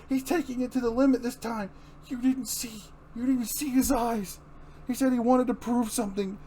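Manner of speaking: high, urgent and fearful; frantic. Acted. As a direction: plead in panic for her father. Barbara frantic about her father.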